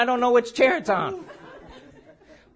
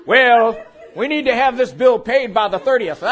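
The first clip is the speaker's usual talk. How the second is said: loud, lightly acted bill collector; insistent creditor; 6 dB louder than usual. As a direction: press for payment in a mock official voice. Plays creditor demanding payment.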